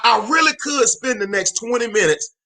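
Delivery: normal talking voice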